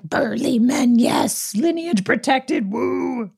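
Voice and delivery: mock macho cheer. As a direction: cheer like a parody of the film.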